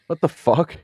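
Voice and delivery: high shocked outburst; surprised. Retold or imitated. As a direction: react in disbelief. Reacting in the retold story.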